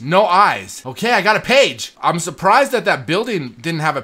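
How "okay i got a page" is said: ordinary delivery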